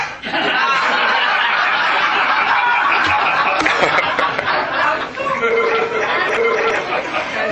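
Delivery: long high breathy laughter; real laughter at the joke. Real, not acted. Preacher laughing at his own horse joke, long laugh.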